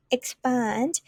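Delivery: ex-PAND, stress on the second syllable. Shown as a demonstration. Teacher modelling expand for students, stressing PAND. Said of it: "put the stress on the second one".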